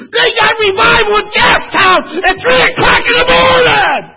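loud, high, crying shout; tearful hollering sermon peak; full-force preaching. Acted. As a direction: wail it out like a revival climax. Preaching climax of the story.

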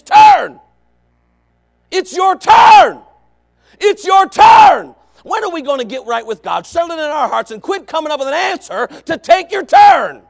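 loud, high preaching shout; preaching at full force. Real, not acted. Preacher shouting to his church, full force. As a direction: drive it home to the congregation.

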